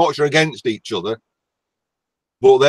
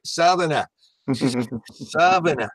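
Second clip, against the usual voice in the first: mock Southerner accent; 4 dB quieter than usual. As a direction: imitate a southern Englander, near Australian. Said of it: "that's like Australian, isn't it?".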